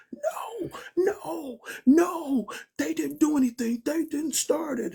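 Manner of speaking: put-on high, pleading, crying old lady voice; a frantic old neighbour begging the cops.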